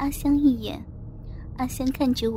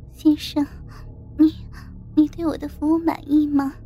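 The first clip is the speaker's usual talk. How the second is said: higher, halting, bashful flirting; coy flight attendant; 3 semitones higher than usual. Acted. Junior attendant to her passenger, coyly embarrassed. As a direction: feign shyness, teasing and coquettish.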